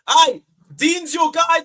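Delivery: high, loud, slow jeer; teasing shout at the chat; loud mocking taunt. Real, not acted. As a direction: call it out to wind them up. Taunting chat about rival fan.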